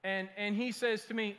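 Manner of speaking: ordinary talk, nothing marked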